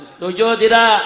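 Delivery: loud question at normal pitch. Real, not acted. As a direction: call out for agreement, not yet shouting.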